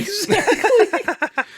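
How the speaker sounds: high laughing exactly, drawn out; cracking up in agreement. Real, not acted. Host agreeing about Mary Sue, high laughing.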